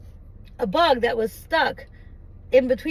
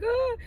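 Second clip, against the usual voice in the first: soft, high mock whimper; a small high pretend sob; sad crying, mimicked; 9 semitones higher, 4 dB quieter than usual. Shown as a demonstration. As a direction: imitate someone crying pitifully. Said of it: "I'm not crying like".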